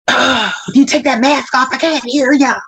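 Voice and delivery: mildly irate mimicry; imitated cussing out. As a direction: gripe like an irritated patient. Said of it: "they are cussing me out".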